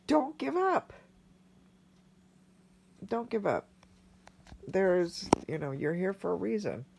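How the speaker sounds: high, choked-up plea with long pauses; real emotion lifting the voice; emotional. Real, not acted. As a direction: urge them on while holding back tears. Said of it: "Sorry, this was really emotional".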